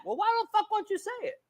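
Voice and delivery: high, fast, incredulous retort; imitating his own comeback to fans; mock challenge. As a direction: throw the question back at them in disbelief. Retells asking fans back.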